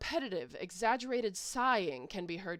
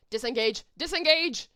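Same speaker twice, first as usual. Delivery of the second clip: panicked call; 3 semitones higher, 8 dB louder than usual. Real, not acted. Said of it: "player retreats in panic".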